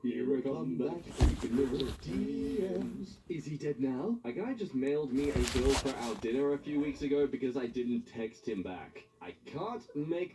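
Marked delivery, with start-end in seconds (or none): none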